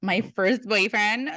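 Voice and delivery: ordinary talk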